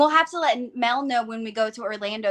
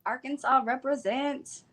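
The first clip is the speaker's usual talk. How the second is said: Arkansas twang; 2 semitones higher, 5 dB quieter than usual. Shown as a demonstration. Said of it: "my Arkansas twang".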